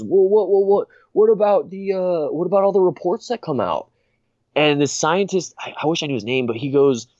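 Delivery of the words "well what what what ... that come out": stammering objection in a mock anchor voice; Brian Stelter. Anchor cuts in on the scientist, mock flustered objection. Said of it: "Brian goes".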